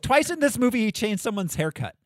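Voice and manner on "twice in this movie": usual speaking voice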